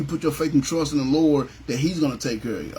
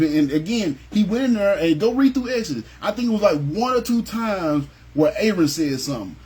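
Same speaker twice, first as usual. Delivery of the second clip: ordinary delivery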